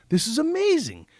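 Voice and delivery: retelling a cowboy's surprised delight, high and excited. Retold or imitated. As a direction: gush it out high. Cowboy's excited reaction retold.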